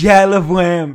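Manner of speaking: very loud happy burst; gushing fan. Retold or imitated. Fan answering the moderator, gushing loudly. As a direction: gush about the band.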